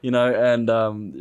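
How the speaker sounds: normal talking voice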